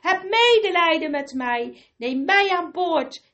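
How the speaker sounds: high, loud terrified begging; desperate pleading stranger. Acted. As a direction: plead for your life from the water. Stranger begs from the water.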